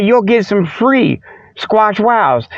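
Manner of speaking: ordinary talk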